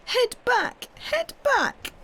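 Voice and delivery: very high, sobbing plea. A narrator's reading. Kezia holding Pat's knees, sobbing very high. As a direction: sob it out, breaking high.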